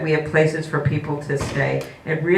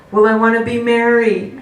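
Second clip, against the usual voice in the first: put-on higher, louder little girl insisting; a pouty kid voice, raised and louder; childlike insistence; 7 semitones higher, 5 dB louder than usual. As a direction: play the stubborn four-year-old, pitched up.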